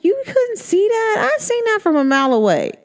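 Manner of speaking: incredulous high voice at a client; exasperated disbelief. Retold or imitated. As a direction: act amazed they missed something obvious. Telling clients what she sees.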